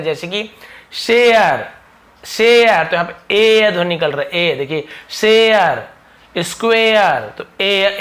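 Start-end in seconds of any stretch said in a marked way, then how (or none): 0.9-2.8 शेयर loud and higher, vowel glide stretched; drawn EY-a in share. Shown as a demonstration. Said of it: "ए ध्वनि भी निकलता है". 5.1-7.2 शेयर, स्क्वायर higher, endings stretched; drawn vowel glide. Shown as a demonstration. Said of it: "ए ऐसे ध्वनि में निकलता है".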